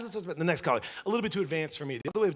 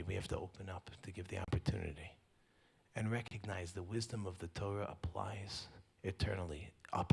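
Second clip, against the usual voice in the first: hushed, lower, earnest preaching; soft sermon; 7 semitones lower, 9 dB quieter than usual. Acted. Rabbi closing the show with Torah wisdom, soft sermon voice. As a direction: draw the crowd in close and teach gently.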